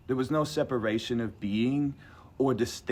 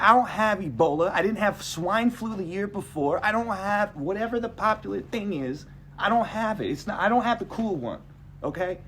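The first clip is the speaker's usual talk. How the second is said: high-pitched, exasperated denial; indignant protest; 8 semitones higher than usual. Retold or imitated. Patient denying Ebola to staff, indignant. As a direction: retell it as an indignant patient's objection.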